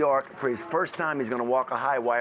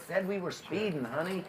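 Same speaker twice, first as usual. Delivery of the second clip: put-on patient old husband; 5 semitones higher, 5 dB quieter than usual. Husband repeats for deaf wife.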